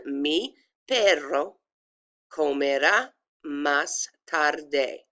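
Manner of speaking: very slow Spanish, double r in "perro", final e of "tarde" sounded; pronunciation demo. Shown as a demonstration. Teacher repeating for students, slow every-letter demo. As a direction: draw out each word of "Mi perro comerá más tarde". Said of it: "I pronounced every letter there".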